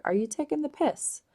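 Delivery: incredulous retort. Retold or imitated. Answering someone giving you a hard time, incredulous and high. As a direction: toss it back as if someone is mocking you. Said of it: "you'd be like".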